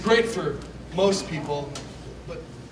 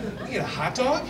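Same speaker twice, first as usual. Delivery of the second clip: rising, higher, amazed; mock astonishment, rising; incredulous question; 7 semitones higher than usual. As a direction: ask it like you can't believe it. Dairy Queen discovery.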